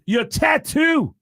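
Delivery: loud, very high, drawn-out rock singer shout. Acted. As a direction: shout each phrase slowly and high. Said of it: "He goes".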